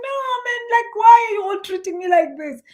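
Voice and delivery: high whiny half-sob, loud at the end; mock crying protest. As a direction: wail it like a wronged child, in jest. Speaker's retold whiny protest.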